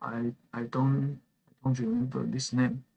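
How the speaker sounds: usual speaking voice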